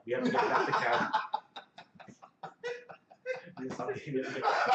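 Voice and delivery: cracking up mid-line while in character; laughing. Acted. Hedwig scolding Karstein to get back to camp, laughing.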